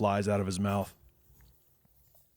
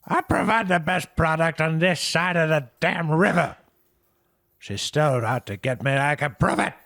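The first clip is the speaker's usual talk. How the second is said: higher, louder indignant boast; boastful Herb; 8 semitones higher, 7 dB louder than usual. Acted. Herb boasting to Jack about his product, a loud indignant boast. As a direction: puff up and insist. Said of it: "Herb voiced in scene".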